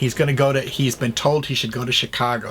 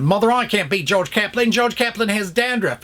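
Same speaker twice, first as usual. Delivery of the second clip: higher, indignant son's complaint; mock indignant plea to mother; whiny Cary Grant; 9 semitones higher than usual. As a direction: pitch it up like a put-upon son.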